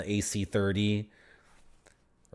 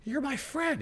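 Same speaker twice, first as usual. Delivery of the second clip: much higher, squeaky surprise; squeaky realization; 3 dB quieter than usual. Retold or imitated. Noob realizing the target was his teammate, squeaking. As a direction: squeak it out as the penny drops.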